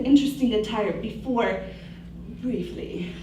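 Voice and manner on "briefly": ordinary delivery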